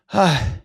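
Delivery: long breaths, then a sighed word; imitated gasping for air; winded panting. Relievers arriving at the brawl, mock winded panting. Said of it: "they're like".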